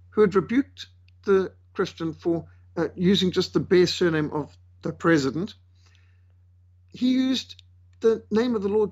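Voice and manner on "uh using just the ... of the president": ordinary delivery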